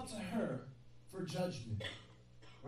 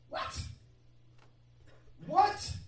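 sudden high loud what; a loud, high disbelieving what; shocked what; 9 semitones higher, 4 dB louder than usual. Real, not acted. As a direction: shout it in disbelief. Outraged at Barak's answer.

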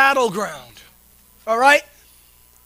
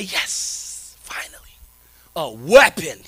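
mock eager whisper; 8 semitones lower, slower than usual. Mocking believers grabbing the sword.

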